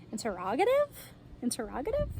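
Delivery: "Interrogative" rising sharply at the end; questioning upswing on "Interrogative"; asked as a question. Shown as a demonstration. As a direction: ask "Interrogative" as a puzzled question, pitch climbing on the last syllable. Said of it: "like we're asking a question".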